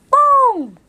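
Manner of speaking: high-pitched catching call; game shout. Shown as a demonstration. Teacher showing what you shout in hide-and-seek, high game shout. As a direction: sing out high to claim a catch. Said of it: "sisigaw ka ng".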